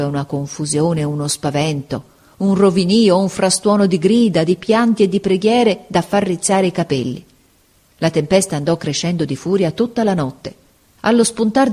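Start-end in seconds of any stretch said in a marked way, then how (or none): none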